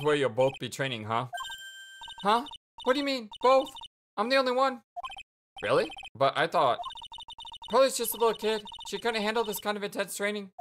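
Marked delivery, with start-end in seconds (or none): 2.2-4.8 put-on perky girl voice. Perky sidekick voice. 7.7-10.5 put-on perky girl voice. Perky sidekick voice.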